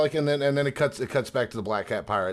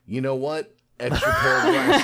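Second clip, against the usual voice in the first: high, loud comic realization; mock bright idea; 5 semitones higher, 7 dB louder than usual. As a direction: pretend a great idea just struck you. Joking imagined villain keeping the glasses.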